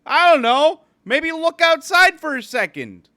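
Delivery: loud, high, slow sarcastic answer; mock exasperated reply. Worker replaying a loud sarcastic answer to a passenger. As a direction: fire back at the passenger, exaggerated. Own retort voiced, loud.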